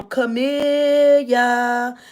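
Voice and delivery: slow, drawn-out eerie calling of a name; spooky eerie voice. Retold or imitated. Pastor's son calling her name in a slow spooky voice. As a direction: drag the name out like a creepy ghost. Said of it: "he said my name in this real weird, spooky, evil voice".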